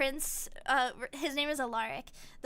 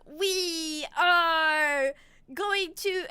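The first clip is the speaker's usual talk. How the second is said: loud, very slow conqueror's announcement; hammed-up invading empire; villainous proclamation; 3 semitones higher, 6 dB louder, much slower than usual. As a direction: proclaim it grandly, stretching every word.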